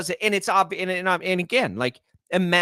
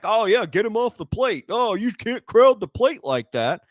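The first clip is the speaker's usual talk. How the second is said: louder, higher imitation of griping fans; hammed-up mock scolding, slower on the second sentence; mock complaint; 5 semitones higher, 4 dB louder than usual. Pitcher's critic mimicking everyone siding with pitchers, mock complaint.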